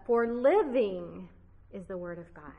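emphatic fronted living; stress on living. Shown as a demonstration. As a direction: hold and hit the word living at the front. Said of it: "it would be translated like this".

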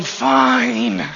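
mock grudging "Fine." from a kid; sulky child. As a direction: imitate a child's reluctant "Fine.". Sulking child walking away.

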